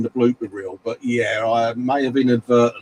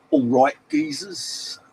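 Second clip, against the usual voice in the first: jokey geezer greeting; 12 semitones higher, 4 dB quieter, slower than usual. Retold or imitated. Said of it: "Skullfish is in going".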